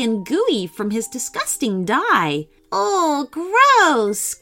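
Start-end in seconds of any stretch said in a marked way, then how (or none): 2.7-4.4 high, grossed-out groan; disgusted cry. Acted. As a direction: squeal at the sticky ink. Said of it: "cried Georgia".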